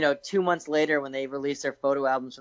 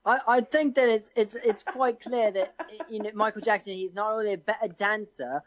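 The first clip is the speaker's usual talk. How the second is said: put-on English accent, higher voice; higher-pitched English debater; 4 semitones higher than usual. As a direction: argue it in a mock English accent. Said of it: "Thirty seconds in an English accent".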